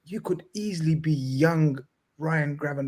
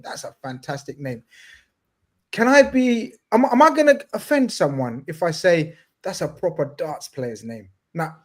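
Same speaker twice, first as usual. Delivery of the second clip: ordinary delivery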